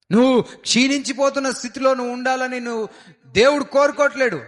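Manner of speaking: high, loud exhortation from the pulpit; rally the congregation with full-voiced fervour; fervent preaching. Pastor exhorting the congregation to believe, loud fervent preaching.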